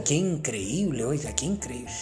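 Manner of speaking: usual speaking voice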